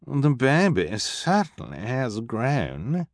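affected glamorous sneer; snooty villainess. A narrator's reading. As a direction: look down your nose as you say it. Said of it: "Esme said".

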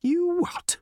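very high-pitched disbelief; incredulous. A narrator's reading. Reporter hears his demotion to restaurant column, incredulous. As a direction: shoot the pitch right up in astonishment. Writer astonished at his new assignment.

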